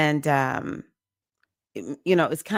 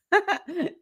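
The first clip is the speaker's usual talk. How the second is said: laugh; 13 semitones higher than usual. Real, not acted. Laughing at own crying remark.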